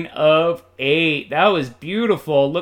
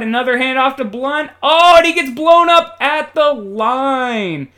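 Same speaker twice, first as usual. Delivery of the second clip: raised, louder call that jumps on "Oh"; high, loud sportscaster outburst; excited play-by-play; 7 semitones higher, 7 dB louder than usual. Pretend. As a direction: erupt as the runner gets stuffed. Live call of a tackle.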